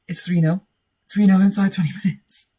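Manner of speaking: high-pitched, excited exclamation; thrilled disbelief. Real, not acted. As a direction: shout the score as if you can't believe it. Third goal, disbelieving celebration.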